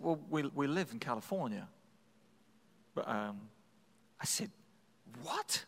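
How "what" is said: ordinary delivery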